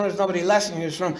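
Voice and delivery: usual speaking voice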